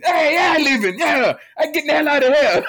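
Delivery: put-on high, loud laughing yell of the old man; hammed-up fleeing pops shouting; mock shout. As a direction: holler while bolting off. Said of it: "the cops was like".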